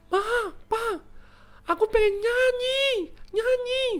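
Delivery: put-on very high, stretched-out child's squeal; child yelling. Vio shouts to the parents when needing to pee, high child yell. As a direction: yell the code phrase like a desperate toddler. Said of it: "mau teriak pun".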